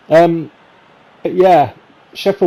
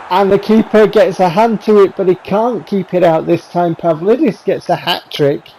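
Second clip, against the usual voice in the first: higher, lifted commentary call; excited retake; 6 semitones higher than usual. Shown as a demonstration. Retaking the goal call for the video, more excited. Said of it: "Can I retake that?".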